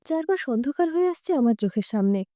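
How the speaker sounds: usual speaking voice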